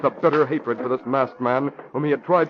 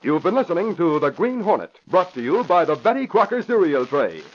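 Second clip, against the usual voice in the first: bright announcer close; radio sponsor sign-off; 5 semitones higher than usual. Acted. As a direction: sell the cereal brand.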